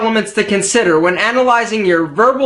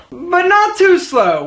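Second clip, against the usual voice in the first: ordinary talk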